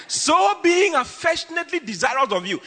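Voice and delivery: preacher reading aloud; scripture reading. Acted. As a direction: proclaim the verse.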